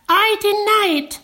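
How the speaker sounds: very high squeaky denial; protesting March Hare. Acted. March Hare denying the Hatter's story, very high voice.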